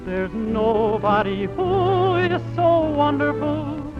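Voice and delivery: ordinary talk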